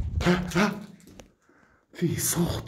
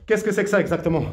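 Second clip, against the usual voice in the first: normal talking voice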